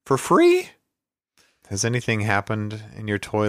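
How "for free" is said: squeaky mock outrage. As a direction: jump up into a shrill squeak. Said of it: "I don't think so".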